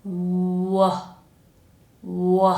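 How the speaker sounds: long, drawn-out "w" with rounded lips; sustained "w" glide, no g; lips-only w. Shown as a demonstration. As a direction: hold the "w", tongue down and relaxed. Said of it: "is only using the lips".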